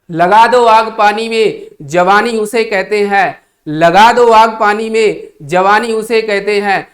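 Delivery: loud, slow poetic recitation; recited couplet. Acted. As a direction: declaim it like a stirring shayari. (ज़ोरदार धीमी शायरी की अदायगी)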